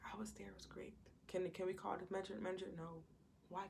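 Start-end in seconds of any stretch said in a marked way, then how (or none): 0.0-1.0 mock whisper. Mocking a faker on a resume.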